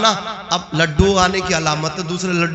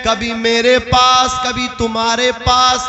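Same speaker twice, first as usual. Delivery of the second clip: high, sustained oratorical chant; slow, ringing proclamation that builds into forceful preaching; declamatory preaching; 9 semitones higher than usual. Acted. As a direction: proclaim it to a crowd, stretching the phrase endings. Preaches Abu Talib's sermon speech.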